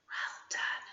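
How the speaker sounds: soft whispered praise; whispered praise to the children. Acted. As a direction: whisper well done.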